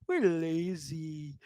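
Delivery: lightly voiced lazy drawl; mild mocking voice of complacent people; mock quote. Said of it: "people are like".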